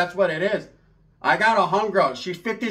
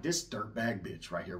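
much lower and softer, slow; muttered aside; 10 semitones lower, 14 dB quieter, slower than usual. Retold or imitated. Narrator cursing Sheila to himself, low mutter. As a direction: grumble it under your breath.

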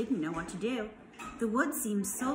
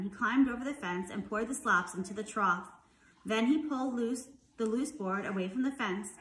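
plain reading voice

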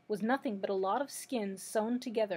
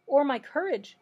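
loud, high plaintive question; dismayed; 5 semitones higher, 8 dB louder than usual. A narrator's reading. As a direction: join the lament, louder and higher. Lion joins the lament.

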